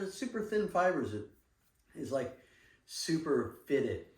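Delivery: high, eager salesman pitch; hyped pitch. Retold or imitated. Retelling the partner's pitch to the guest, eager and high. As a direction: sell it like the product is the next big thing.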